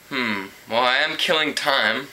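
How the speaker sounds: ordinary delivery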